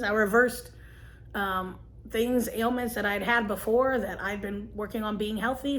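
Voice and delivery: ordinary talk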